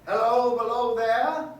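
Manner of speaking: loud, slow call down into the cutting. Retold or imitated. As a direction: hail someone far below, drawn out. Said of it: "did you not call out".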